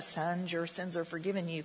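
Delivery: the wrong way. Shown as a demonstration. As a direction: say "Son, your sins are forgiven you" the lifeless way he rejects. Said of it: "I can't ever read that in a ... kind of way".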